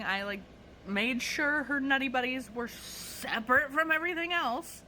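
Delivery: ordinary delivery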